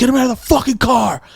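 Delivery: high, strained mock outburst; imitating dad losing it. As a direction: act out a furious father's demand. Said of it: "He lost his fucking mind".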